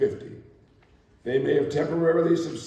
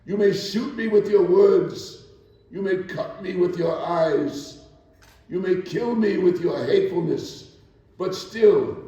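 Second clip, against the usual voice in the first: defiant; 6 semitones higher, 4 dB louder than usual. Acted. Poem climax, louder and higher.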